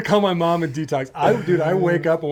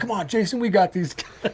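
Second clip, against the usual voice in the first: worked-up mock battle cry; retold fired-up bravado; 6 semitones higher than usual. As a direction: re-enact egging a friend into a fight. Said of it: "went off".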